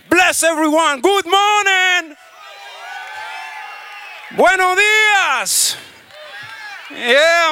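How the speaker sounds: slow, high drawn-out greeting shout; hearty drawn-out shout to the audience; stage greeting. Real, not acted. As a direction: stretch the greeting out to the crowd.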